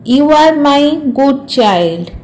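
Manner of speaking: ordinary delivery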